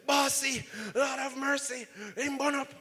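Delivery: high, alarmed Jamaican worker's cry; alarmed workers. Retold or imitated. Coworkers seeing the boss scalded, alarmed high cry. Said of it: "the guys ... say".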